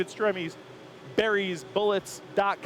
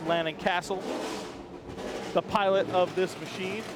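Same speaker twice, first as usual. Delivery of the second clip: ordinary talk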